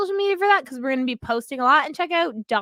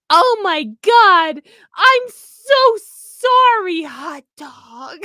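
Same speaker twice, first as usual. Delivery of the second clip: put-on high, loud, slow childlike squeal; high slow loud kid's imitation; imitating daughter; 4 semitones higher, 9 dB louder, much slower than usual. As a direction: mimic the little girl's squealed line. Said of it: "now I got her going".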